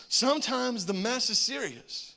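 usual speaking voice

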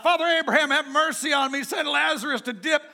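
high, loud plea from the flames; pleading cry. Acted. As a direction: cry out begging for mercy, pitch up. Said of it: "And he called out".

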